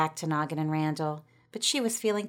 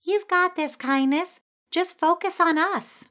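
much higher, louder cheering voice; encouraging; 11 semitones higher, 6 dB louder than usual. A narrator's reading. Ginger urges Kindness across the cable, high encouraging call. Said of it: "Ginger encouraged her".

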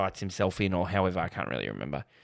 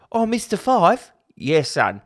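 high, loud mock Aussie film dialogue; hammed-up Australian accent, pitch leaping up; mimicked Aussie accent; 8 semitones higher, 5 dB louder than usual. Host acts out the Bogo Pogo exchange, hammed-up Aussie accent. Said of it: "The way they say it".

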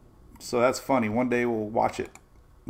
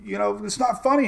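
high, put-out protest; mock-indignant, pitched up; retold indignant scolding; 9 semitones higher than usual. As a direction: re-enact a peeved telling-off. Retold scolding of laughing girl.

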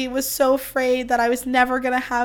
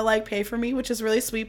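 ordinary delivery

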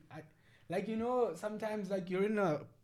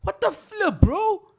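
loud, high-pitched outrage; aghast, voice shooting up; real shock; 7 semitones higher, 14 dB louder, slower than usual. Real, not acted. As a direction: cry out in appalled disbelief. Reacts to punch on old man.

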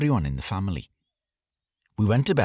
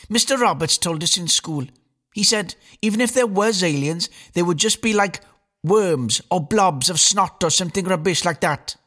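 high, slightly louder schoolgirl voice; know-it-all girl; 9 semitones higher, 3 dB louder than usual. A narrator's reading. Eldest daughter Eileen lectures her brother at dinner, high and bossy. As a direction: lecture him like a bossy big sister. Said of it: "snapped Eileen".

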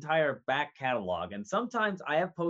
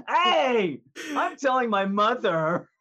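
put-on whiny kid voice; 8 semitones higher, 8 dB louder, slower than usual. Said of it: "I'm like".

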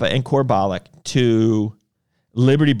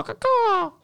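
high squawking caw; bird caw. Shown as a demonstration. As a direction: screech it like a bird. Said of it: "change the bird one to caw-caw".

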